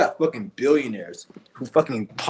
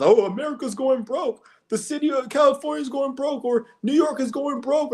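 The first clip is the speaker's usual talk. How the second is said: much higher, whiny mock voice; mocking dumb people; 14 semitones higher than usual. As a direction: parody them in a shrill-pitched sneer.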